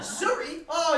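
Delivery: normal talking voice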